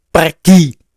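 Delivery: loud, final command; sharp dismissal. Acted. Jesus ordering the disciple away, loud and final. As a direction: send him away with one loud word.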